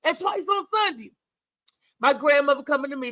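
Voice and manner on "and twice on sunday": much higher, fast sarcastic jab; hammed-up sassy mimicry; mocking retort. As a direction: throw the phrase back at her, mocking. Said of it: "I was like".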